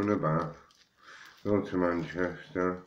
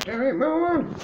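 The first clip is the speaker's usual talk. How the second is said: high, playful name call; singsong call; 6 dB louder than usual. Acted. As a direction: sing his name out high.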